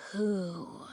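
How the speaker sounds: put-on creaky, rough old dragon voice; eerie rasp. A narrator's reading. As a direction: scrape the word out like claws on ice. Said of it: "she rasped suddenly".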